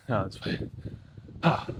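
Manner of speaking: ordinary talk, nothing marked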